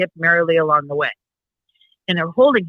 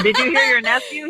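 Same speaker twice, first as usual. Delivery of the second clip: laughing; 7 semitones higher than usual. Real, not acted. Laughing, asking about nephew.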